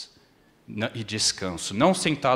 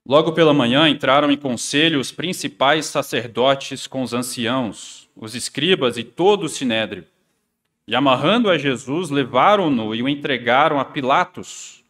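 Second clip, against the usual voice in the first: louder, formal Bible reading; scripture reading; 3 semitones higher, 7 dB louder than usual. Acted. Preacher reads Mark to the congregation, loud and formal. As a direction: proclaim the passage to the church.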